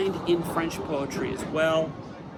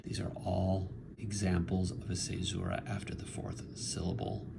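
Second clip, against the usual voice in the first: whisper; 6 semitones lower, 11 dB quieter than usual. Real, not acted. Whispered explanation.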